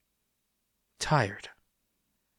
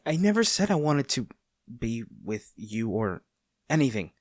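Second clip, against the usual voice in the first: flustered denial; 2 semitones higher, 5 dB louder than usual. Acted. Captor scolding the smirking prisoner in the cell, flustered and loud. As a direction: protest too much, voice jumping up, caught out and blushing. Denies feelings, accused of blushing.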